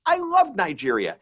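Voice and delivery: high, gushing retold exclamation; enthusiastic. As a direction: play up the delight for a laugh. Retold joke to audience member.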